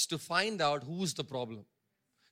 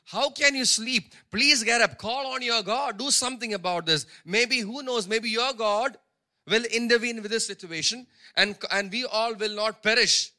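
louder, higher urgent plea to wake up; pleading captain; 4 semitones higher, 7 dB louder than usual. Retold or imitated. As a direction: rouse the sleeping prophet, desperate. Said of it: "this captain came to him and said".

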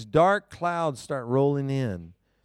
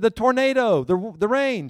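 frantic; 8 semitones higher, 5 dB louder than usual. Retold or imitated. Frantic call to wife.

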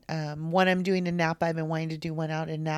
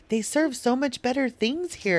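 higher-pitched mock-quoting voice; imitating Danny; 7 semitones higher than usual. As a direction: put on a friend's voice, pitched up. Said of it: "Danny's all".

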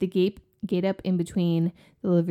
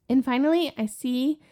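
usual speaking voice

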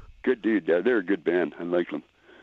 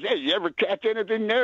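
lightly voiced kid, pitched up high; kid asking; 11 semitones higher than usual. Kid calling to a one-armed fisherman, high and eager. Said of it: "And the kid goes".

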